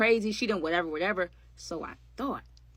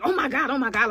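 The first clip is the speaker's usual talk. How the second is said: retold yelling; 5 semitones higher, 5 dB louder than usual. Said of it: "I'm just yelling".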